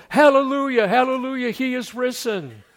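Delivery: high, loud mock rejoicing; joyful hallelujah. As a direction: shout it like triumphant good news. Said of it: "doesn't rush back into town and go".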